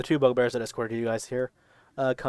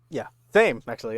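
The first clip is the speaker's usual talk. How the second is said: normal talking voice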